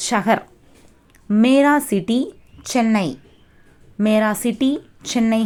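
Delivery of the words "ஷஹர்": ஷஹர் as "sha-har", short vowels; Hindi pronunciation. Shown as a demonstration. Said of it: "ஹிந்தியில எப்படி pronounce பண்ணணும்". (ஷஹர், இந்தி உச்சரிப்பில் "ஷ-ஹர்")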